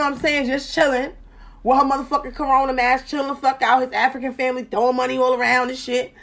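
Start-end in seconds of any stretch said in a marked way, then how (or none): none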